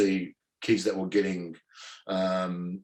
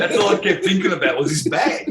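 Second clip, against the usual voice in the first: high laughing disbelief; 8 semitones higher, 7 dB louder than usual. Real, not acted. Telling a friend about the lost bag, laughing. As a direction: laugh at your own past self.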